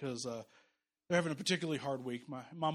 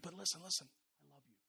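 tender father; 2 semitones lower, 8 dB quieter, faster than usual. Acted. God as good father, tender.

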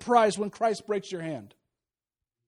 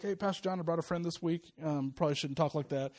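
low, much softer aside; uneasy church member; 6 semitones lower, 9 dB quieter than usual. Acted. Church member gently chiding the pastor over a guest, hushed. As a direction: voice a hushed, awkward complaint. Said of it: "you guys are gonna be like".

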